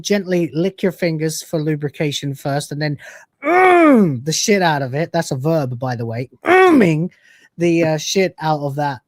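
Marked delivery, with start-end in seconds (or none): 3.4-4.2 high, loud drawn-out "mm"; a loud hum sliding down from high; emphatic mm. Pretend. Host urging viewers to hit the button, emphatic hum. Emphatic noise standing in for a verb. 6.4-7.1 high, loud hum falling into "-ing"; emphatic mm-ing. Pretend. Host urging viewers to hit the button, emphatic hum. The noise reused as a verb.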